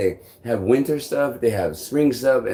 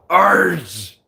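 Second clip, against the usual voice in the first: loud, higher squawk; shouted creature cry; 8 semitones higher, 6 dB louder than usual. Retold or imitated. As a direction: yelp it out like a cartoon beast. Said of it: "these stupid voices".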